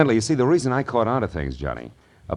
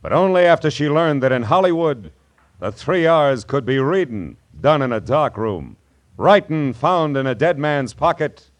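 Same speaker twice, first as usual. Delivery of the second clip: higher, punchy detective voice-over; dramatic teaser; 6 semitones higher, 5 dB louder than usual. Acted. Marlowe teasing next week's murder story, dramatic announcing. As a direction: sell next week's mystery, pausing between clauses.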